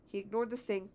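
plain reading voice

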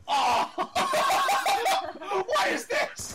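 high squealing laughter; laughing in disbelief. Real, not acted. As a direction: crack up in disbelief. Knifed, bursts out laughing.

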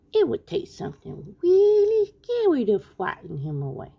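high, slow cartoon canary; little bird voice. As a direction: lisp it sweetly like Tweety. Tweety's voice again.

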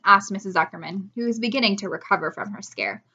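plain reading voice

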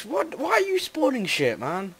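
high, startled and indignant; shocked disbelief at a cheater; surprised outrage. Real, not acted. Sees friend spawning blocks.

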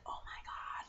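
hushed whispered gasp; mock shocked whisper. Nicole's shocked reaction, retold.

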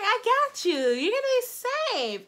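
high-pitched imitation of a coaxing sister; mock-soothing high voice; reassuring sister. Sister reassuring her in retelling.

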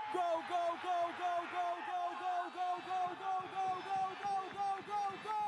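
high goal chant; goal scream. Real, not acted. Second goal called. (grito de gol)

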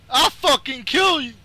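high, heated yell; yelled threat. Real, not acted. Gamer threatening his online opponent, yelled threat. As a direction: burst out with the threat.

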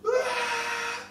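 high-pitched drawn-out shriek; piercing imitated cry. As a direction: let out a sudden high shriek. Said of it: "she lets this shriek out".

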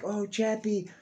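high, coaxing put-on voice of a scheming parent; manipulative parent voice. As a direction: sweet-talk the robot into doing your bidding. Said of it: "they was like".

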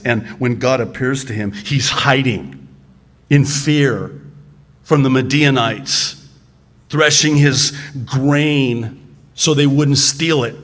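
ordinary talk, nothing marked

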